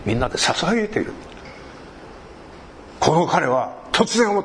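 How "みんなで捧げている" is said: normal talking voice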